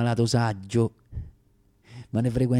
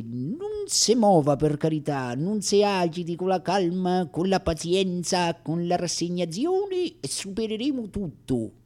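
imitating the doctor; 8 semitones higher than usual. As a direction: mimic a doctor's pious bedside advice, pitched up. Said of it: "mi dava a mie spese di queste ricette".

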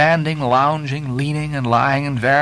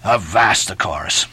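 plain reading voice

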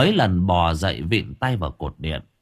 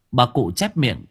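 ordinary delivery, nothing marked

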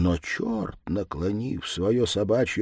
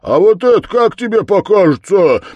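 loud, triumphant challenge; blacksmith shouting; 10 semitones higher, 10 dB louder than usual. A narrator's reading. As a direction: throw it at the devil, raised pitch. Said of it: "закричал кузнец".

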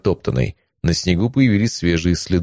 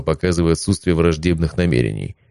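plain reading voice

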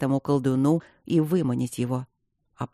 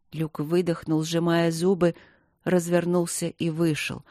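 usual speaking voice

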